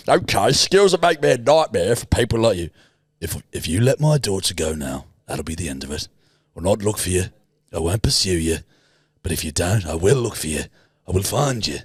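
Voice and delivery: gangster threat sinking low; Alfie. Acted. Gangster giving a kidnapper an ultimatum, low and menacing. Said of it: "Right, fucking Alfie".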